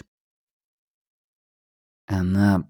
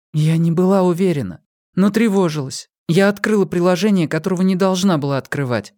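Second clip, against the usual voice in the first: put-on higher, hesitant young woman; 8 semitones higher than usual. A narrator's reading. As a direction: confess timidly as a young woman. Pip voiced by narrator.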